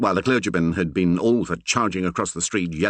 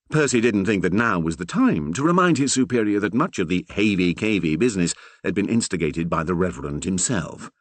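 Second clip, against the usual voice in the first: ordinary delivery, nothing marked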